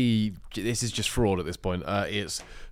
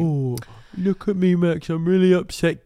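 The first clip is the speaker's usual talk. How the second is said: mocking whiny voice; 8 semitones higher, 6 dB louder than usual. Mocking the host being upset.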